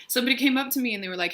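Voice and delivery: ordinary talk, nothing marked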